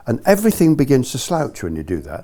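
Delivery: ordinary talk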